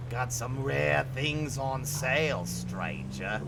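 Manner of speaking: deep, soft, slow merchant voice; a low raspy shopkeeper drawl, Resident Evil style; imitating the game's hushed merchant. Quoting Resident Evil merchant line.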